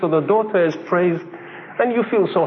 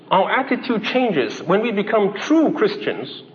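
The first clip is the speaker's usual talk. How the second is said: ordinary delivery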